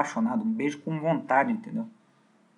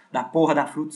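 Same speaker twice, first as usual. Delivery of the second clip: ordinary delivery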